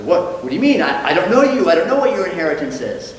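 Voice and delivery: flustered acted protest, high and loud; upset rabbi. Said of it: "now the rabbi is upset".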